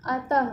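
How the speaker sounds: अतः with a clear final h breath; visarga demo. Shown as a demonstration. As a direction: end अतः on an audible h. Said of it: "वर्ण के आखिरी में आया है ना ये".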